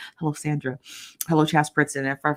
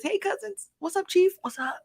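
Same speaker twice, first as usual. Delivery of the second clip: reading a chat comment in a high voice. Retold or imitated. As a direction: coo a viewer's greeting. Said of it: "Sheree says".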